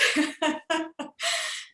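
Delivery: high, light burst of laughter; real laugh. Real, not acted. Speaker telling how surgery turned out, laughing. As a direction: laugh midway through your sentence.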